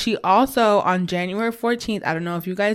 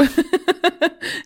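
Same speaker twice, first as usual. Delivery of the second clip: high laugh; 11 semitones higher than usual. Real, not acted. Laughing at own story.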